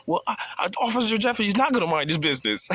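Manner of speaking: high, squeaky mockery of the flustered officer; mock guard. Teller laughing at the guard, high squeaky mockery.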